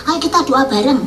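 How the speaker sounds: ordinary talk, nothing marked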